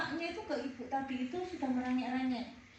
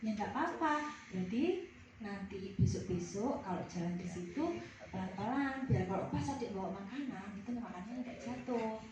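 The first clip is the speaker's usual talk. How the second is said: calm reassurance; 5 semitones lower, 4 dB quieter than usual. Acted. Trainer models calm counseling reply.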